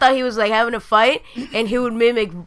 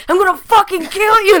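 higher, louder mock threat; 9 semitones higher, 6 dB louder than usual. Mocking the man who yelled at a spider, hammed-up threat. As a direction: ham up a furious threat. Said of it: "I don't get the whole".

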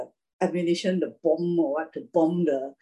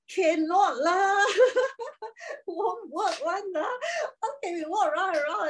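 laughing doubters; 13 semitones higher than usual. Acted. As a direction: imitate scoffers while laughing.

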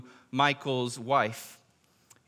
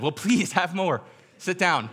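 higher, louder, slow coaxing invitation; eager hospitable welcome; 6 semitones higher, 5 dB louder than usual. Retold or imitated. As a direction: urge him warmly to stay. Group welcoming the gunman.

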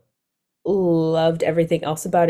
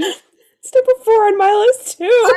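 high laughing voice; 7 dB louder than usual. Real, not acted. Laughing high-pitched realization.

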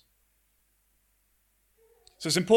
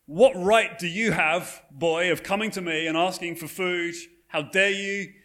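raised, indignant put-on scolding; incredulous master; 4 semitones higher, 4 dB louder than usual. Master scolding Oliver for asking for food, incredulous and indignant. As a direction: play an outraged master, higher and emphatic. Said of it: "the master is absolutely incredulous".

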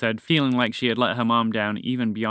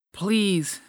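panting; 7 semitones higher than usual. A narrator's reading. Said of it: "panted Fred".

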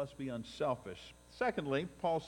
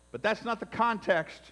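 ordinary delivery

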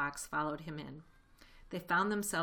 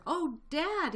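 high, loud excited youngster; cried out; 12 semitones higher, 6 dB louder than usual. A narrator's reading. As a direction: cry out to Dad in delight. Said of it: "he cried out".

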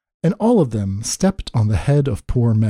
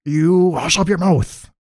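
mildly howled royal rebuke; understated storybook howl; 3 semitones higher than usual. A narrator's reading. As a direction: scold like an affronted king. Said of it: "howled the mighty King Yertle".

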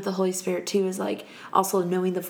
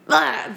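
reluctant groan; 7 semitones higher, 6 dB louder than usual. Retold or imitated. Reluctant reaction to a call.

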